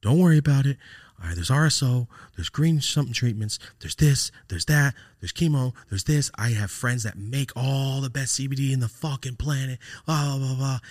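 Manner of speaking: high-pitched, urgent listing of hope; holding it together. Retold or imitated. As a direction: rattle off remedies to keep from breaking down. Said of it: "trying not to, like, break down".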